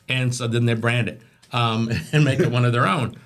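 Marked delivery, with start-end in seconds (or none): none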